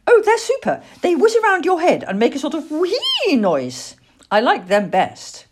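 put-on high, loud, gushing small boy; excited little boy. A narrator's reading. Boy tells owlet about flying saucers, excited little boy. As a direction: gush about it like a thrilled child.

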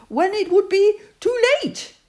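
small, high, squeaky little piglet voice. Acted. Narrator voicing Piglet fretting about the trap, high and squeaky. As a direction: squeak it out high like a tiny worried animal.

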